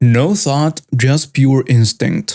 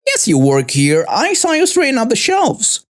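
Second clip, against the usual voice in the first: rising much higher into an insistent Karen voice; Karen insisting; 9 semitones higher than usual. A narrator's reading. Karen insists the veteran works at the store, high insistent voice. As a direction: push it up high, stubborn and accusing. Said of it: "Karen insisted".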